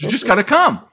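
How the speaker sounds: high, loud mock woman's voice; insistent refrain. Woman giving every question the same answer, loud mock insistence. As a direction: hammer out her stock answer, high and loud.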